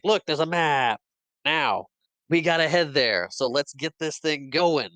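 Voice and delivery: playfully pumped-up retold speech; rallying speech. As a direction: act out rousing the crowd.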